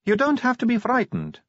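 high-pitched vampire recruit; Sally. A narrator's reading. Vampire Sally voiced high by narrator.

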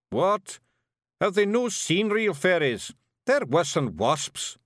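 loud, high Scots disbelief; Scottish Feegle, scornful. A narrator's reading. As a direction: scoff as an outraged little Scot. Voices Big Yan, scornful.

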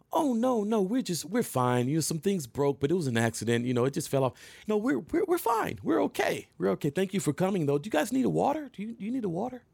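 high sweet mock voice, going way up; hammed-up polite suburban lady. Girlfriend to the cops, high sweet mock politeness.

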